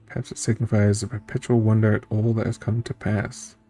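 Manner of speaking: ordinary talk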